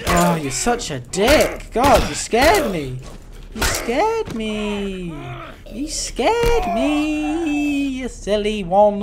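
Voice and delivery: slow, drawn-out teasing scold; mock scolding. Real, not acted. Gamer teasing the monster that scared him, slow. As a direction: tease the monster playfully.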